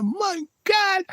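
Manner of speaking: very high squeal of amazement; acted-out, squeaky disbelief; awestruck. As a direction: let the voice shoot way up in wonder. Acts out amazement at pie.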